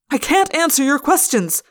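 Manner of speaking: high, loud protest; frustrated yell. A narrator's reading. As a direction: yell it, cornered. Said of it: "I yelled".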